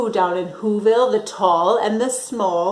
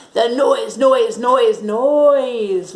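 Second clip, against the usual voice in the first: louder, punched noise noise noise; loud stressed noise; 2 semitones higher, 6 dB louder, much slower than usual. A narrator's reading. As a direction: hammer each noise with disgust. Grinch's hated noise.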